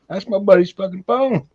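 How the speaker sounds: high-pitched indignant retort, retold; instantly shitty. Telling a stranger off about buddy's phone, instantly shitty. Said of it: "I was instantly shitty".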